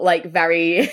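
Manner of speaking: ordinary talk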